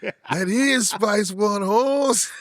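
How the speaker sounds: put-on Chicano accent. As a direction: imitate the dude confirming, drawn out. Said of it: "He said".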